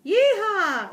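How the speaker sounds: high, loud whoop; cowboy yell. A narrator's reading. Hamster riding on the frog's back, yelling. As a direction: holler like a bronco rider. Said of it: "I yelled".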